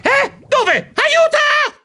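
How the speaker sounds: very high, panicked yelps; terrified shriek. A narrator's reading. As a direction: shriek for help in terror. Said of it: "strillai terrorizzato". (strillo terrorizzato)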